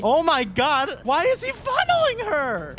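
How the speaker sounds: high, loud, slow shocked outcry; shocked disbelief. Real, not acted. Watching Makoto grope her, shocked high outcry. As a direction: recoil in disbelief at the screen. Horrified at game choice.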